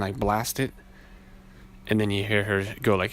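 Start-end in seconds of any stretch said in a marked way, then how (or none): none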